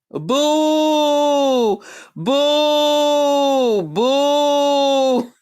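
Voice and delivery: drawn-out heckling on one note; jeering boo. Real, not acted. Jeering the rival's unoriginal material.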